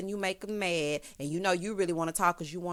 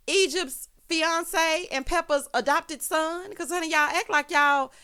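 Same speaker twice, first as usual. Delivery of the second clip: loud, high-pitched mock disbelief; incredulous rant; 8 semitones higher, 5 dB louder, slower than usual. Real, not acted. Recapper calling out the cast, loud high incredulity. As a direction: push it up high, loudly incredulous.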